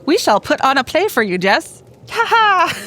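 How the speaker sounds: theatrical proclamation rising to a high laugh; mock-grand showman. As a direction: announce a play with hammed-up grandeur. Mock-grand promise of a play.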